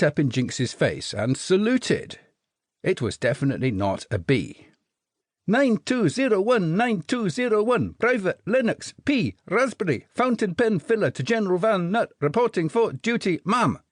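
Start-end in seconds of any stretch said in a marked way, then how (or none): 5.5-13.8 staccato Scottish report, higher, no breath; thick Scottish accent. A narrator's reading. Jelly baby soldier reporting to Jinx, staccato Scottish. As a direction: rattle it off in a thick Scottish brogue. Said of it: "in staccato rhythm, without stopping for breath, and in a thick Scottish accent".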